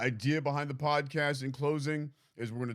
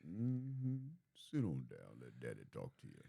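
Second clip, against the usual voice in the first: low, silky lover-man voice; smooth seductive voice; 9 semitones lower, 11 dB quieter than usual. Shown as a demonstration.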